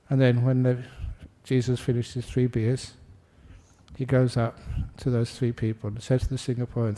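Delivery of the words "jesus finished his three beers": normal talking voice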